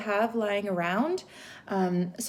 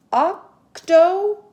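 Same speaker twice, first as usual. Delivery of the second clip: syllable chin count; 6 semitones higher, 7 dB louder, slower than usual. Shown as a demonstration. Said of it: "we could do our syllables".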